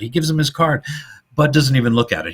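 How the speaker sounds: ordinary talk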